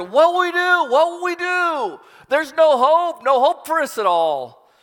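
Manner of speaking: high, loud panicked crying out; terrified wail at the enemy army. Retold or imitated. Terrified servant cries to Elisha, high panicked wail. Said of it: "this servant cries out".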